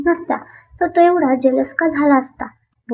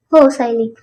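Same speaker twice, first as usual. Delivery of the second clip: ordinary delivery, nothing marked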